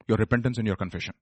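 soft, low, quick answer; hushed answer. Acted. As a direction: drop the voice for the answer. Answers own question softly.

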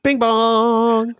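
high, drawn-out chime call; mock chime. Co-host chiming to open the show, high mock chime. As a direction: ring it out like a doorbell, held long.

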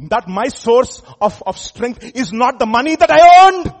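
slow preaching swelling to a loud, high peak; building proclamation. Real, not acted. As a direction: build steadily to a shout on the last words.

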